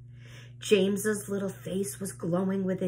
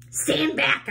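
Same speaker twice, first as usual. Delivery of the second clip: higher, raised warning shout; 7 semitones higher, 3 dB louder than usual. A narrator's reading. Aunt Sponge warns everyone back, raised shout. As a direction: call out a warning. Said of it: "Aunt Sponger shouted".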